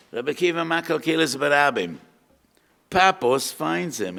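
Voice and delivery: full-voiced sermon delivery; preaching build-up. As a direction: rouse the congregation into the tale.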